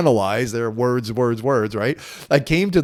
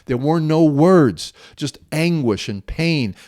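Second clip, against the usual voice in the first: ordinary talk, nothing marked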